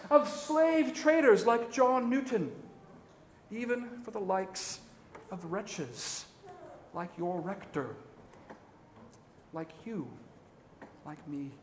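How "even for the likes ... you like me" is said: fading to quiet. Real, not acted. Preacher confessing he and the hearers are wretches, fading soft. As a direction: let each phrase sink quieter, ending near a murmur.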